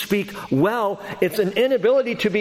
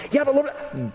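protesting Moses; 8 semitones higher than usual. Acted. Moses objecting to God.

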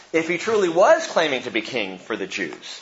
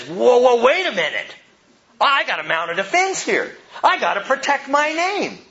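high-pitched, indignant mock protest; defensive reaction; 7 semitones higher than usual. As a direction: jump in to defend yourself, hammed-up. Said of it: "my reaction, my flesh says".

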